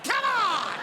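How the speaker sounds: high, loud cry of frustration; exasperated outburst. Retold or imitated. Husband to wife who ruined the joke, exasperated. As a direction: burst out in comic disbelief.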